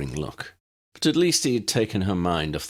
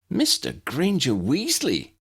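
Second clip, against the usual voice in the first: mock retort; 11 semitones higher, slower than usual. A narrator's reading. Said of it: "Demelza retorted".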